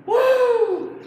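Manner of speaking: high, loud imitated whoop; John's excited whoop. Imitating John excited about the rapture, high whoop. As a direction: let out a big mock "woo". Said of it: "He's going".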